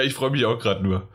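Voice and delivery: ordinary delivery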